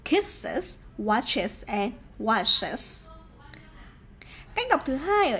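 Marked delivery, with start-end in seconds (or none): 0.1-3.1 slow "kisses, watches, washes", each with an iz ending. Shown as a demonstration. Teacher showing students how to read them, slow iz endings. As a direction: spell out the second syllable of "kisses, watches, washes" as iz. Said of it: "các bạn phải đọc là".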